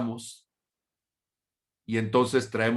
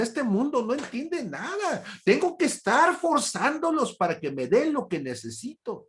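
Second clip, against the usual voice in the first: put-on much higher, whiny baby protest; mock complaining baby; 12 semitones higher than usual. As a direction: play the newborn demanding attention. Voicing a newborn's complaint. (bebé que se queja con voz aguda y quejumbrosa)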